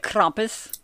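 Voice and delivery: "Krampus" said the German way, with an open "ah"; German pronunciation. Shown as a demonstration. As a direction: say "Krampus" as KRAHM-poos, in German. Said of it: "like, in German pronunciation?".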